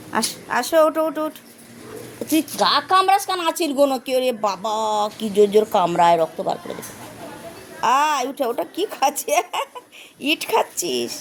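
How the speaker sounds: ordinary delivery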